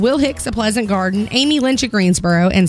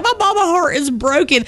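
mock crying; 7 semitones higher than usual. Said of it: "I start crying again".

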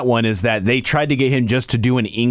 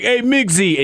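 higher-pitched New York greeting; New York accent; 7 semitones higher, slower than usual. Retold or imitated. Wrestling buddy greeting the host, New York accent. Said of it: "he'll talk with you and like".

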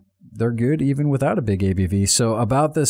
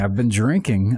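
usual speaking voice

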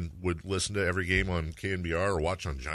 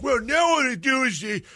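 put-on much higher, louder cranky old man; Joe Pittman old man voice; 8 dB louder than usual. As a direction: grumble it like a grumpy old-timer. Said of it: "I channel my Joe Pittman old man voice".

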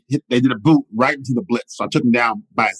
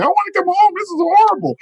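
much higher, whiny mock-crying plea; imitating crying freshmen; 3 dB louder than usual. Freshmen on payphones calling mom, mock crying. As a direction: play a homesick kid crying to his mom. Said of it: "crying, like".

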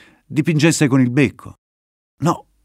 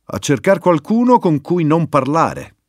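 grumbling reply in a changed voice; grumpy retort; 6 semitones higher, 3 dB louder than usual. Acted. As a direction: surly snap from the young viper. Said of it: "ribatté scontrosa Viperella".

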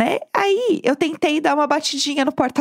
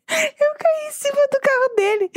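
laughing while telling; 11 semitones higher than usual. Real, not acted. Laughing about falling onto car.

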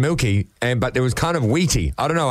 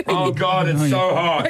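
mouth on fire; 6 semitones higher, slower than usual. Real, not acted. Mouth burning from hot sauce.

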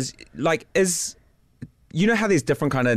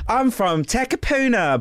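higher, stretched posh Auckland voice; posh Auckland accent; 8 semitones higher, slower than usual. Pretend. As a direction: play posh Auckland, slow and high. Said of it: "It's quite posh".

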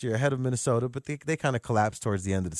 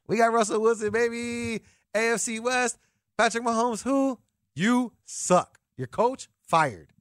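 lightly voiced gloating Denver fan, pitched up; cocky Broncos fan; 9 semitones higher, slower than usual. Host mocks a boastful Broncos fan, pitched-up gloating. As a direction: brag like a smug Broncos fan over his new quarterback. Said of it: "You're the Denver Broncos".

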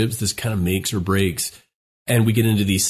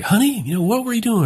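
sharp, accusing tone going much higher; harsh startup; 13 semitones higher, 3 dB louder than usual. Shown as a demonstration. Wife confronting husband at the door, accusing and high. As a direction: snap at a partner who came home late. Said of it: "our tone goes up".

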